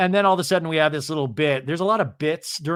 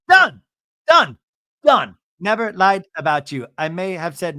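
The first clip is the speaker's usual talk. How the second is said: emphatic verdict; 3 semitones higher, 5 dB louder than usual. Retold or imitated. John declaring the dispute over, in punched shouts. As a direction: shout each word separately, with pauses.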